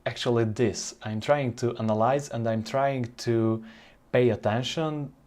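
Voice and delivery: ordinary talk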